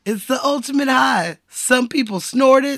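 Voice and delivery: high, lightly voiced Bodhi quote; hint of a surfer guru; mock surfer guru. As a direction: preach it like a blissed-out surfer. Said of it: "he's like".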